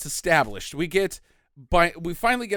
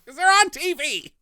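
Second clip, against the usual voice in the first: put-on giddy kid; 9 semitones higher, 4 dB louder than usual.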